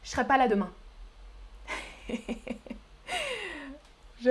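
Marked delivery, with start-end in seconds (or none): 0.0-0.7 je serai run together as ch'rai; rapid casual speech. Shown as a demonstration. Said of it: "Je parle rapidement". (« je serai » vite, prononcé ch'rai)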